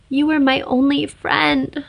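tearful. Acted. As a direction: say it through held-back tears. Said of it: "Her nose was clogged from crying".

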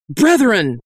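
high, ringing call to the flock; preacher's cry. Acted. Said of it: "I cried".

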